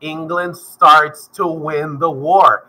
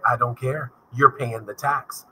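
low, soft and slow, a lightly voiced cold king; dismissive king; 7 semitones lower, 8 dB quieter, slower than usual. Teacher voicing the king refusing the colonists, low and dismissive. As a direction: brush them off like an uncaring monarch. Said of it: "And the king's like".

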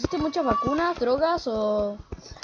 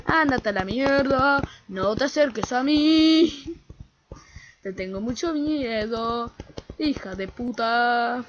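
slow scared; slower than usual. Real, not acted. Slow drawn fearful insults.

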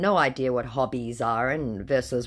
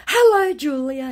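high, louder sing-song hello; mock sweet greeting; 13 semitones higher, 8 dB louder than usual. Scammer greeting Julia hours later, mock crooning. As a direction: croon the name like a fake suitor.

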